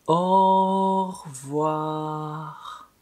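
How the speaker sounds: Au revoir stretched very slowly, au held long; slow-motion teacher demo of Au revoir. Shown as a demonstration. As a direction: draw out every sound of Au revoir. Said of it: "とってもとってもゆっくり".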